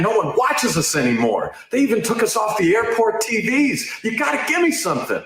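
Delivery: desperate pleading. Acted. Reporter begging press secretary for a story, desperate. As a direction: beg in a hammed-up desperate voice.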